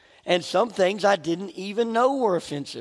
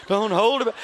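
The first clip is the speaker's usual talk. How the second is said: ordinary talk, nothing marked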